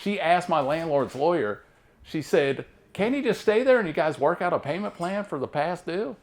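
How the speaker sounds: normal talking voice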